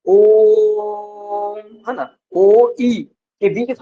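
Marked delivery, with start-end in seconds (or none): 0.1-1.6 ओ held very long on an even pitch; prolonged vowel. Shown as a demonstration. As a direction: sustain ओ like one long steady note. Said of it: "थोड़ा सा समय लगे बोलने में".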